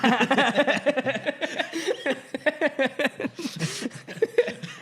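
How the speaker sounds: long, high fit of giggles; helpless laughter. Real, not acted. Podcaster cracking up at his own joke, helpless laughter.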